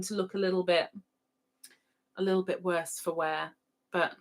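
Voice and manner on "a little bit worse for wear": ordinary delivery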